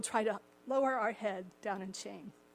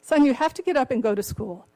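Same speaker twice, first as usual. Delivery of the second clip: louder, insistent dad; father insisting son go to school, firm and louder; 3 semitones higher, 8 dB louder than usual. Retold or imitated. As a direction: lay down the law to the kid. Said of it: "the father says".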